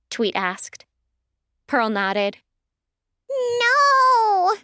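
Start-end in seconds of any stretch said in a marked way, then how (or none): 3.3-4.6 very high, drawn-out bird squawk. A narrator's reading. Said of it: "Tweet squawked".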